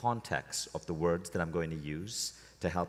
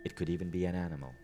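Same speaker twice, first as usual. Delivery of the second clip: guided meditation. Acted. Leading a room into a relaxed state, guided meditation. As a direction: lead them inward, unhurried and measured.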